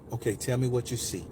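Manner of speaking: low, soft coaxing; smooth, subtle voice. Retold or imitated. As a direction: imitate a soothing woman gently guiding. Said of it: "this very smooth, subtle voice".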